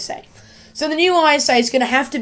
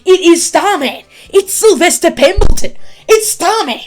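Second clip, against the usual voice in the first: loud, high, thrilled reveal; excited shouting; 5 semitones higher, 6 dB louder than usual. Real, not acted. Fan shouting to viewers about Starman's return, excited.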